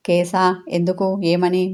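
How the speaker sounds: ordinary delivery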